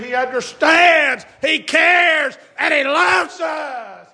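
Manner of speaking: high, loud, slow climbing sermon shout; preaching at full force. Acted. Preacher shouting hope to the congregation, full force. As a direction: build to a roaring preacher's cry.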